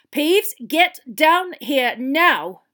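loud, high, very slow command; barked order. Acted. Teacher ordering the poltergeist down, stern bark. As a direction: bark it out word by heavy word. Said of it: "barked Professor McGonagall".

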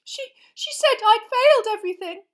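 high, loud and stammering; panicked and near tears; terrified sobbing. Acted. Girl telling the teacher what she saw, panicked. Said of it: "Hermione gasped".

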